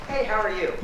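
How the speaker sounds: sing-song doorstep hello; mock friendly greeting. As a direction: play the nice neighbour knocking, high and sunny. Mock friendly knock at door.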